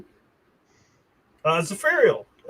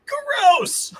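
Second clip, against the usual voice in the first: disgusted shriek; 5 dB louder than usual. Acted. GM reacts to dwarf blood.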